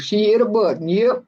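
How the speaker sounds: usual speaking voice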